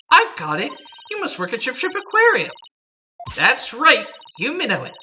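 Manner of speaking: high, chirpy performer; bubbly pirate-show entertainer; perky showgirl voice. Acted.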